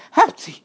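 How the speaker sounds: loud high acted sneeze; explosive mock sneeze. A narrator's reading. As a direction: burst out with a short sneeze. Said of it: "A tüsszentés közben".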